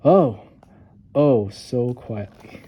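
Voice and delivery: put-on reading the lion's creep to children; suspense. A narrator's reading. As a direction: draw it out to build suspense. Narrator builds suspense.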